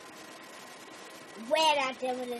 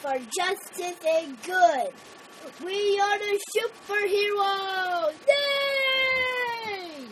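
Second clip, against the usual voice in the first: higher, louder rallying hero cry; triumphant hero cheer; 7 semitones higher, 4 dB louder, much slower than usual. Retold or imitated. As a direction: cheer it out, building to a yell. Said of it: "And then Shiryu said".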